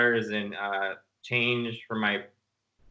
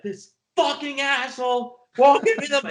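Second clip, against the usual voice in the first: screaming; 14 semitones higher, 4 dB louder than usual. Retold or imitated. Manic son screaming to his dad on the phone. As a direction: scream it like you've lost it. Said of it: "screamed to my dad".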